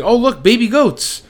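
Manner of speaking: high, loud, drawn-out fake enthusiasm; mock excitement. [name] distracts the whole bus, mock-excited. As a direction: exclaim it slowly as a put-on diversion.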